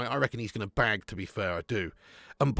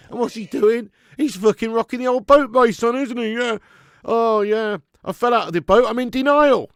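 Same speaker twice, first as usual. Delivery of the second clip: excited mocking rant; 7 semitones higher, 8 dB louder than usual. Real, not acted. Mocking Salah rocking the boat.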